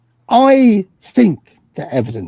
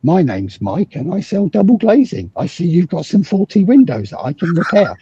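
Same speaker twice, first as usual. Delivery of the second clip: a hint of a chirpy double glazing salesman, pitched up; cheery salesman; 6 semitones higher than usual. Mocking a scam salesman at the door, in a lightly cheery voice. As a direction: lightly play the friendly doorstep seller. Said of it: "knock on your doorbell and say".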